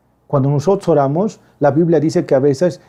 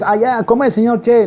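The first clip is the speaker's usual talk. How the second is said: higher, mock-casual chumminess; overfamiliar buddy; 7 semitones higher than usual. Preacher mocks treating God as a mate, too casual. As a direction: greet God like a street pal. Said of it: "No nomás porque amigo es".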